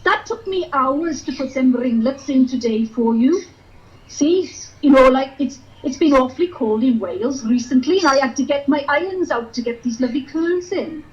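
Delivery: put-on sing-song Welsh valleys accent; chirpy Welsh valleys girl. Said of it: "I'm from the valleys".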